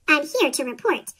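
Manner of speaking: ordinary delivery, nothing marked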